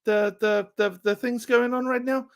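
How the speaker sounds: put-on high, broad New Zealand accent like Korg; laid-back Kiwi bloke impression. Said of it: "the Thor movies, the real".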